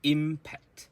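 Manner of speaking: impact with a loud, high, long IM; long first syllable. Shown as a demonstration. Teacher drills rhythm for learners, impact with long stressed IM. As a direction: hold IM in impact, then short pact. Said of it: "The im is longer".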